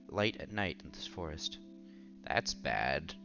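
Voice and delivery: ordinary talk, nothing marked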